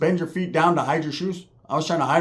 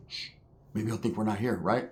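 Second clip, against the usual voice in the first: hushed and much softer, hiding; 9 semitones lower, 12 dB quieter than usual. Retold or imitated. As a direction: keep your voice down so he won't hear. Hiding from the visitor.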